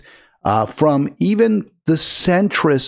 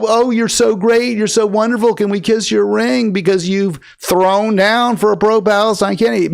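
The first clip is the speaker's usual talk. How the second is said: higher, sarcastic gushing praise; mock fawning; 7 semitones higher than usual. The host mocks fans who fawn over the congresswoman, in sarcastic gushing. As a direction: gush over her sarcastically. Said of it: "We're supposed to".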